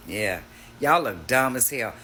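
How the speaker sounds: normal talking voice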